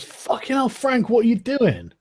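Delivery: high-pitched yell without much volume. Acted. Startled naked guy to his friend Frank, yelling. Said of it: "looks over your shoulder and just yells".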